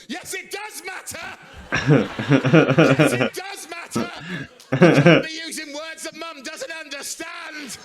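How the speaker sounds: high, strained mock yell; hammed-up indignant brotherly telling-off; scolding older brother. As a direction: shout it like an outraged big brother. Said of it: "back to being an older brother".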